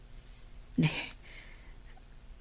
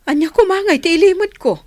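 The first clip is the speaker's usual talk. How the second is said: sharp raised protest, loud and high; defensive outburst; 11 semitones higher, 8 dB louder than usual. Acted. Mother protesting to her son, loud and defensive. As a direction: snap back, stung by the accusation. Mother denies hiding something.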